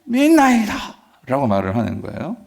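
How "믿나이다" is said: high acted exclamation; reenacted high-pitched outcry; crying out. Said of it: "그 소리를 지르면서 뭐라고 얘기해?".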